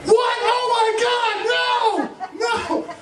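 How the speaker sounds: high shrieking outburst, drawn out; hammed-up fan dismay; mock horror. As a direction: screech in mock disbelief. Imitating their horrified reaction.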